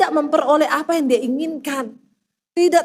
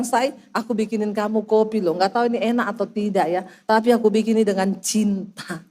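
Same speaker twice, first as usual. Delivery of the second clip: loving husband imitation; 6 semitones lower than usual. Husband offering his wife coffee made with love, tender. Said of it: "Tahu-tahu dia bilang".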